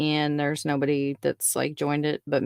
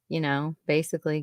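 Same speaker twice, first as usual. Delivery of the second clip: ordinary delivery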